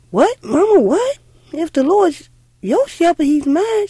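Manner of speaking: put-on a scared kid insisting; alarmed child. Little girl protesting to her mother that the Lord must be hers too, loud and alarmed.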